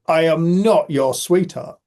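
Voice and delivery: slow, loud mock indignation; indignant retort. Offended shopper snaps at a stranger, slow imitated indignation.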